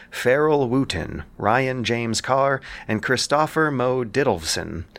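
ordinary talk

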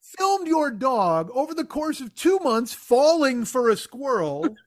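high, slow disbelief with a laugh; mock outraged astonishment; incredulous. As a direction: stop and drag out the disbelief. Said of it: "And I'm like".

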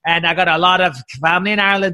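normal talking voice

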